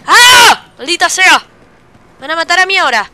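high, loud scream into a curse; frustrated yelling. Real, not acted. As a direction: shout in alarm at being hunted. Killed and hunted in game.

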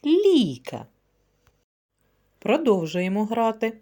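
ordinary delivery

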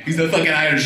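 ordinary talk, nothing marked

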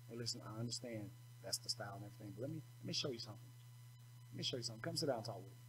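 gentle tone. Retold or imitated. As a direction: coax him kindly, quietly. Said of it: "talk to 'em in a tone that says".

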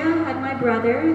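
usual speaking voice

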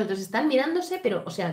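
normal talking voice

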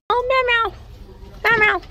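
very high, loud squeaky meows; playful kitty squeal; cat meowing. Pretend. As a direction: meow like an excited little cat.

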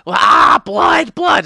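high, loud bloodthirsty yell; hammed-up feral shout; crazed shout. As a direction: scream for blood like a mad pixie. Crazed pixie attacking.

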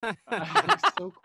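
high, delighted laugh-through line; thrilled at the plot twist, laughing. Real, not acted. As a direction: giggle it out in delight.

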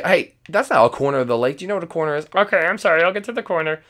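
ordinary talk

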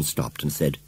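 plain reading voice

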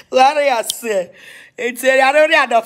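high, loud talk breaking into laughter; laughing. Real, not acted. Vlogger cracking up at own catchphrase, laughing loudly. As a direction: laugh through the words.

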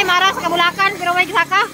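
talking while chewing; eating fruit while speaking; mouth full. Real, not acted. Seen 1: Face clear; she bites and puts fruit pieces in her mouth while speaking.

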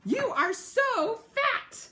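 a flock of shrill little birds; chirpy bird voices. A narrator's reading. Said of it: "they said to him".

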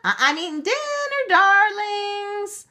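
put-on high, loud sing-out to the children; calling out. A narrator's reading. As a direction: call the kids in to eat. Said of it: "Auntie Kathleen called".

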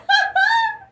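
very high, loud cackle of laughter; shrieking real laughter; cracking up. Real, not acted. Cracks up at own whoop.

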